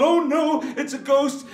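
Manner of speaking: high, loud frightened cry; terrified thief. Acted. The thief sees glowing eyes and cries out in fear. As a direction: shriek it in fright. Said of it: "And he thought".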